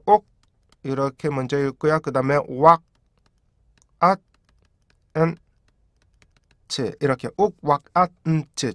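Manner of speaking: 옥, 왁, 앗, 은, 치 spoken as separate beats; syllable drill. Shown as a demonstration. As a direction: chop want into five stop sounds. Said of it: "다섯 개의 음절처럼".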